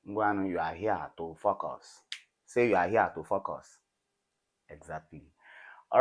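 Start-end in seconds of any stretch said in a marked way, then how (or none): 0.1-3.8 louder mock Igbo-accented urging. Teacher jokingly rallies the class to focus, in an Igbo accent. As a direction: lightly imitate an Igbo English accent. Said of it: "Igbo people in Nigeria pronounce focus".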